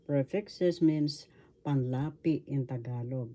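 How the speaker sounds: normal talking voice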